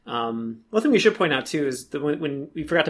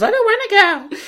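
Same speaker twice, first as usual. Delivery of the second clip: high whiny whimper; 6 dB louder than usual. Retold or imitated. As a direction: whine like a scared kid.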